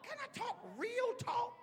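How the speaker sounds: high, loud shout of a question; preaching shout. Real, not acted. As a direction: cry it out to the whole church.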